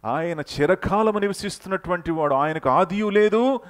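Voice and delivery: high, loud, slow proclamation; preaching climax. Acted. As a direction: lift the crowd with each phrase. Preaching climax about God.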